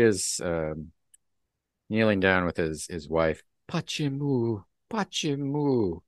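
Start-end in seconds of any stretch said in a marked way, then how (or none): 3.7-6.0 high, drawn-out lament; anguished Russian husband. Acted. Husband kneeling by his comatose wife, anguished pleading. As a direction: plead in Russian, acting grief.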